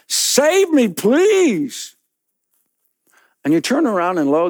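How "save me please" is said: high, pleading cry; desperate plea. Acted. Man in the pit begging to be saved, high desperate plea. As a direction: beg for rescue from the pit. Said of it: "That's kind of".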